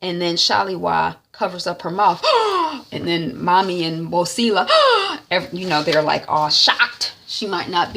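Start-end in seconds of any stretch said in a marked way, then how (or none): 2.3-2.9 sharp high gasp; mock gasp. Family shocked at visitor. 4.7-5.2 sharp high gasp; mock gasp. Family shocked at visitor.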